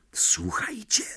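urgent voiceless whisper; tense, breath-only alert; feverish whisper. A narrator's reading. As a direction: whisper it to hush your friends. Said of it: "szepnął gorączkowo Harry".

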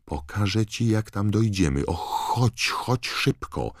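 high, breathy, excited coaxing; eager urging. A narrator's reading. Hermiona urges Harry to follow. (podekscytowane, wysokie ponaglanie)

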